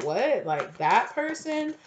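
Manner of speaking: high incredulous what, stretched out; shocked disbelief. Pretend. Viewer reacting to an unexpected ex reaching out, incredulous. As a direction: gasp at who it is. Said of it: "You could be like".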